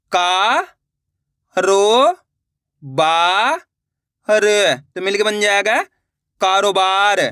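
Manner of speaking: each syllable held apart, then the word kारोबार joined; syllable reading demo. Shown as a demonstration. Teacher asking pupils to copy his way, syllable demo. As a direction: model the reading slowly for them to copy. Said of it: "मैं किस तरीके से ... पढ़ रहा हूँ".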